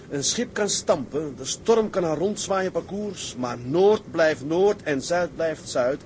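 slow, impassioned oratory; fervent declaration. Acted. Captain defending his dream to a doubting friend, fervent. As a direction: declaim it with conviction, drawing out the words.

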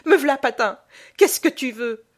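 high, loud, obedient answer; hurried-to-please wife calling out; startled obedience. A narrator's reading. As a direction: jump to answer a feared husband. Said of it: "soulevée par l'obéissance".

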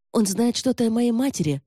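high, heated outburst; snapped retort. A narrator's reading. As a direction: snap back at the objection. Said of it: "рявкнула Ромейн".

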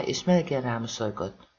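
plain reading voice

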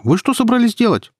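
high-pitched indignant challenge; shouting. A narrator's reading. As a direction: call out in alarm, voice way up. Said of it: "крикнула Кира".

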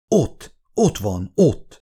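mildly breathless excitement, jumping up at the end; excited pointing out. A narrator's reading. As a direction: point it out urgently, drawing out the first words. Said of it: "mutatott izgatottan".

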